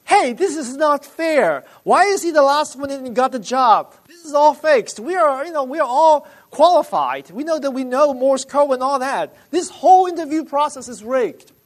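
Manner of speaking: high, loud acted outrage; loud complaining. Rejected applicants protesting to the interviewer, loud high complaint. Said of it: "started to voice out complaints saying".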